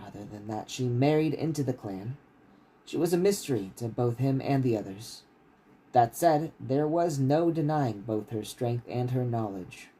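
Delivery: steady, measured reading of a fantasy novel; story narration. A narrator's reading. Author reading his novel to stream listeners, steady narration. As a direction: read the chapter out like an audiobook.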